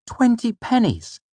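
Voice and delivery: put-on high young boy's voice, full of scorn; scathing. A narrator's reading. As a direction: spit it out with boyish contempt. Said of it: "I said scathingly".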